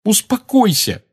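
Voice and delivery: high-pitched indignant echo; heated exclamation. A narrator's reading. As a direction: throw the word back hotly. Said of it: "горячо воскликнула она". (горячо воскликнуть)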